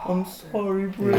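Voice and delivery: high, put-on sorry voice; mock remorseful apology. Apologizing to his brother after punching him, high sorry voice. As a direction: whine the apology like a guilty kid. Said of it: "I was just like".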